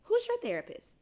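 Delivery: very high-pitched mimicked question; prying acquaintance. Someone turns to her about therapy, high mimicked prying. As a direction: pipe it up high, quizzing a friend. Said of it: "turn to me and say".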